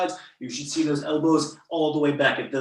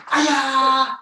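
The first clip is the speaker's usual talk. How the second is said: loud, high martial arts kiai; sharp karate-class battle cry; loud yell; 13 semitones higher, 8 dB louder than usual. Shown as a demonstration. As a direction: yell it out high, falling away at the end. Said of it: "So single yell".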